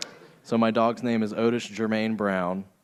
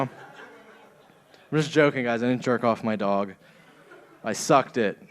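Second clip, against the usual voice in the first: ordinary talk, nothing marked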